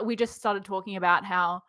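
ordinary talk

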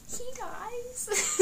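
very high, strained squeak; real tearful. Real, not acted. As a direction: choke it out while close to tears. Breaks up telling character's death.

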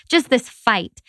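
normal talking voice

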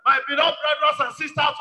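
ordinary delivery

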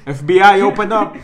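play-acted federal agent shouting; mock FBI raid shout. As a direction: bellow it like a joke raid at the door. Mock police raid joke.

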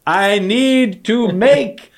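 high, slow, loud pleading; high drawn-out frustrated plea; exasperated insistence. Retold or imitated. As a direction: stretch it out in mock exasperation. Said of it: "And I said".

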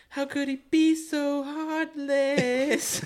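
high, very slow melodramatic wail; mock heartbroken girl. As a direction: ham up a wounded girl's inner voice. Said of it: "in her head she's like".

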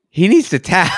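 lightly acted urgent aside; onlookers' reaction.

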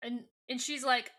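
ordinary delivery

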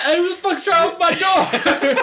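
hammed-up outraged manager; angry manager voice. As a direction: play the furious manager bursting out.

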